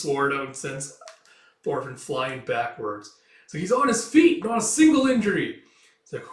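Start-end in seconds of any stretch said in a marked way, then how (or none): 3.6-5.6 high, loud exclamation; amazed onlooker. Acted. Spectator seeing the boy unhurt, amazed shout. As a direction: marvel aloud at the fighter.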